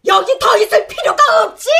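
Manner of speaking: insulted grandma storming off in a huff; indignant outburst. Acted. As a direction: blurt it out half crying, half shouting. Insulted mother-in-law storms off.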